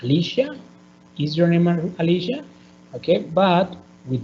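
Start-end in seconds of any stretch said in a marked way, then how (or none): 0.0-0.6 "Alicia?" lifted steeply at the end; lone word "Alicia?" with an upward glide; rising intonation. Shown as a demonstration. 1.2-2.4 "Is your name Alicia?" with the pitch climbing sharply on "Alicia"; yes-no question, rising on the last word "Alicia"; rising question intonation. Shown as a demonstration.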